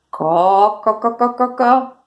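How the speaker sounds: one long cluck, then a rapid string; hen clucking. Shown as a demonstration. Teacher giving kids a clue for the hen, clucking. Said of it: "The hen says caw".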